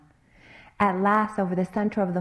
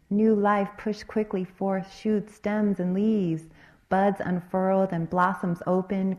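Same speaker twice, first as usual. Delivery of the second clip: ordinary delivery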